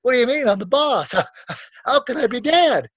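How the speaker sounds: high, playfully baffled reply; mock incredulous. He answers the panicking man, mock incredulous. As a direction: feign innocent disbelief, amused.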